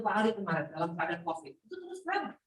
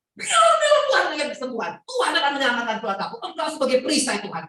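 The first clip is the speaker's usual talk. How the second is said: loud, high-launched proclamation of faith; forceful declaration; 4 semitones higher, 7 dB louder than usual. Shown as a demonstration. Preacher showing how to speak scripture over trouble, forceful declaration.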